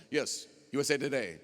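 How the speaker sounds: a mock reporter calling out at a press conference; joking about his friend's coming out like a press conference, as a lightly voiced reporter. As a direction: play a journalist raising a hand at a briefing. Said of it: "like a news interview".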